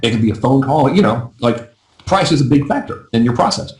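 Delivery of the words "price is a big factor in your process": normal talking voice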